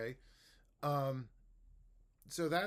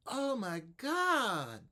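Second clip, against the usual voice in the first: much higher, slow, drawn-out oh my God; exaggerated intonation; 11 semitones higher, 6 dB louder, much slower than usual. Shown as a demonstration. Teacher draws big tune for student. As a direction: swoop the tune wide. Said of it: "using your finger to match your intonation".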